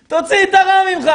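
high, loud, rapid command; full-force preaching. Acted. Preacher ordering the listener to expel evil, full-force preaching. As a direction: drive it out of them with a shout.

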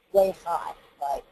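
ordinary talk

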